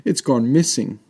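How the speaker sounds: put-on a small boy crying over his loss; understated childlike sob; sobbing boy. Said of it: "he burst out in tears, sobbing all over again".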